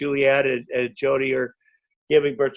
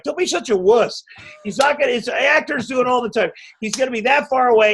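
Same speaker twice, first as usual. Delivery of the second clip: high, teasing scold; mocking scolding; 7 semitones higher than usual. Co-star mocks the nervous actor, high teasing scold. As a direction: chide him as a wuss. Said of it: "even Julianne Moore's like".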